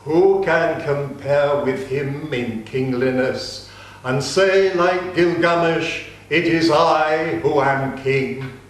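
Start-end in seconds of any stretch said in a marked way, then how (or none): none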